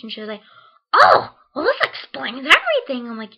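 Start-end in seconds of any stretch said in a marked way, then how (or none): 0.9-3.1 much higher, much louder mock teacher voice; dry, loud I-told-you-so; teacher's sarcastic relief.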